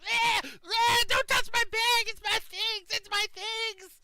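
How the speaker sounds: high, very loud frantic screams; screaming. Acted. Bloodied prisoner still screaming at the party. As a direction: keep shrieking despite the kick. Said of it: "He's still going".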